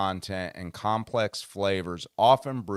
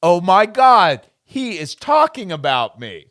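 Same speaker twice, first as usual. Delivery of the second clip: shocked realization; 9 semitones higher, 13 dB louder than usual. Retold or imitated. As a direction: realize with horror they mean you. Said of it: "And I went".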